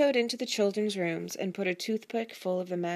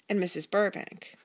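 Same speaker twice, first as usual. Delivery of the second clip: ordinary delivery, nothing marked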